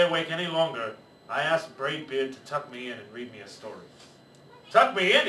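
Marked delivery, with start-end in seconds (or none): 4.7-5.3 louder, higher indignant bellow. Acted. Pirate captain scoffs at the bedtime request, bellow. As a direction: bellow it in disbelief. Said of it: "he bellowed".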